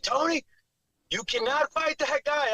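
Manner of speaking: high, drawn-out mock warning; urgent warning. Fighter quoting a man from the crowd, urgent high warning.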